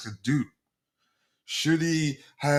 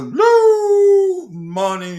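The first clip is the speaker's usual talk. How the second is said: high, long, loud hoot on Blue; sustained high-pitched title cry; drawn-out show call; 10 dB louder, much slower than usual. Real, not acted. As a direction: stretch Blue into a soaring whoop. Show host calls out show title.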